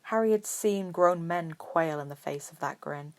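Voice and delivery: ordinary delivery, nothing marked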